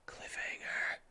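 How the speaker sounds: soft dramatic whisper. Acted. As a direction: breathe it out as a teaser. Ending the story chapter.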